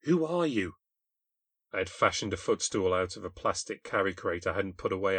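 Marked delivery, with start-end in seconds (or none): 0.0-0.8 sharp, high accusing question; accusing girl's voice, pitched up. A narrator's reading. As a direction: demand it like a frightened stranger. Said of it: "She looked up at me accusingly".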